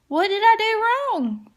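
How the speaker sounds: playing her confused self; puzzled.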